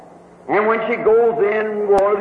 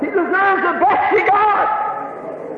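preaching at full force; 9 semitones higher, much slower than usual. Acted. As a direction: drive it home to the congregation. Preacher exhorting congregation.

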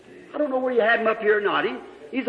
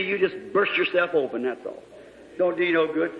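ordinary talk

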